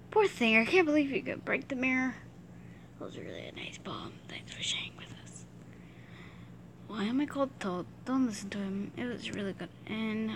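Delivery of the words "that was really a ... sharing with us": much softer whispered mock praise; unvoiced sarcastic compliment. Real, not acted. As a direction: breathe it as a sly aside. Mock praise under breath.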